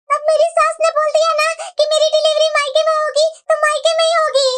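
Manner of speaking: ordinary delivery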